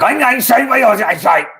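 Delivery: loud, high imitation of angry-sounding German; mock angry German. Imitating a German speaker chatting to a friend, mock angry. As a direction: rattle off mock German like a furious phone call. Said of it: "having an angry phone call".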